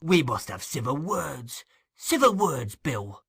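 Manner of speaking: pleading, pressing for calm; placating old fence. Acted. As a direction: urge them both to settle down.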